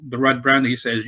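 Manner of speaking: ordinary delivery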